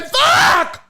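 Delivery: shrieked curse, way up high; high comic shriek. Retold or imitated. Patient cursing in panic at the doctor. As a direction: squeal the curse in mock panic.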